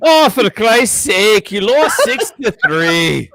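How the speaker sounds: loud, slow mock British accent; a British accent with a laugh in it. Shown as a demonstration. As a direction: redo the line in a hammed-up British accent. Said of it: "Do it in the British accent".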